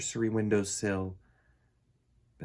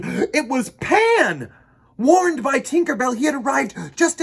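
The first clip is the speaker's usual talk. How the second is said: excited reveal; 9 semitones higher, 7 dB louder than usual. A narrator's reading. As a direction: announce the hero's arrival with delight.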